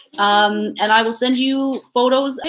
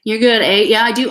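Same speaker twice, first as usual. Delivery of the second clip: usual speaking voice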